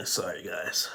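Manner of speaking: choked-up, whispery apology; real teary-eyed. Real, not acted. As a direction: apologize through a sigh, holding back tears. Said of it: "I'm getting even teary-eyed just thinking about it".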